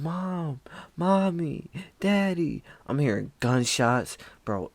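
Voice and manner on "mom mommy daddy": imitated screaming people. Teller voicing people in the woods crying for parents, high mock cries. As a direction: mimic terrified victims calling for mom and dad. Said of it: "I hear people screaming".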